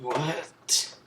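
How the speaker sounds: high, loud mock-shocked what; stunned disbelief. Whole class reacting to the refusal, stunned disbelief. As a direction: burst out in acted disbelief.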